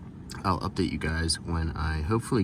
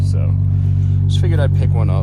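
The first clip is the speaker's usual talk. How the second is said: raised voice over engine; 4 semitones higher, 10 dB louder than usual. Real, not acted. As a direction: talk loudly over a roaring engine. Talking over a running exhaust.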